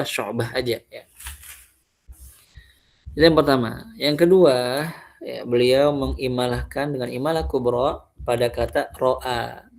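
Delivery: usual speaking voice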